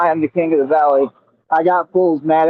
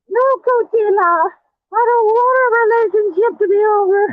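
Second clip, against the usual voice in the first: mocking whiny plea; 14 semitones higher than usual. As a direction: mimic a pathetic ex begging to be taken back. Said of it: "I'm laughing at you".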